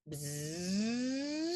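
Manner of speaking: long buzz sliding up in pitch; bumblebee buzz. Shown as a demonstration. Teacher shows students a vocal warm-up, rising bee buzz. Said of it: "using the word buzz".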